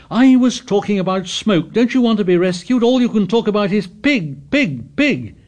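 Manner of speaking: loud, high, angry outburst; indignant. A narrator's reading. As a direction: lose control in anger. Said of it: "Indignation took away Ralph's control".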